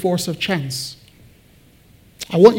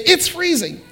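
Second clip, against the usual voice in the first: It's freezing, with much higher pitch and a falling end; dramatic complaint; 10 semitones higher than usual. Retold or imitated. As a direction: pitch It's freezing up high, then let it drop. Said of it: "Now say".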